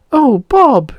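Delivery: very high, loud delighted cry; a little girl awestruck; amazed girl. Acted. Bonnie seeing Bob transformed, very high delighted voice.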